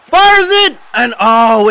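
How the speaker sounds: sudden high, loud burst; explosive play-by-play; excited goal call. Real, not acted. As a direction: shout the strike as it happens, then ease down. Live call of penalty kick.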